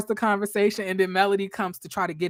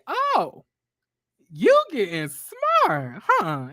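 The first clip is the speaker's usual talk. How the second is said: sassy Kimmy comeback; much slower than usual. Retold or imitated. Retelling a show fight, voicing Kimmy's slow sassy comeback. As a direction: draw it out with attitude. Kimmy voiced snapping back.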